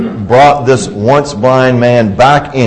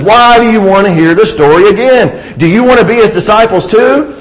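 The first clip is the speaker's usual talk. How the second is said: exasperated and bold, pitched higher; exasperated retort; 8 semitones higher than usual. Acted. Healed man snaps back at the grilling Pharisees, exasperated. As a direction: toss the question back in their faces. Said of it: "he's exasperated, but he's emboldened".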